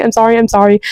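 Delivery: ordinary delivery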